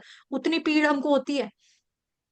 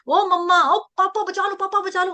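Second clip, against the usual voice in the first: higher, a touch of panic calling mummy and papa; mock scared cry; 4 semitones higher, 4 dB louder than usual. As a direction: cry out to mummy and papa in a dream, half-mocking. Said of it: "हम ये करते हैं".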